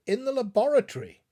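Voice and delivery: loud, fast, firm answer; a firm, clear reply. A narrator's reading. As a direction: state it plainly to calm him. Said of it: "as clear and as firm a tone".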